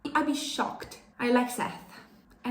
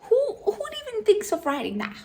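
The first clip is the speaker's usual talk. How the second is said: incredulous; 11 semitones higher, faster than usual. Real, not acted. Baffled outburst.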